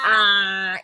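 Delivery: loud drawn ah sound; open-wide ah. Pretend. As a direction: say ah for the dentist. (입 벌리고 아 소리)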